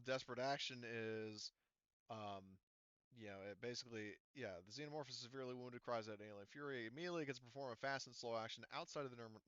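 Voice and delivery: ordinary talk, nothing marked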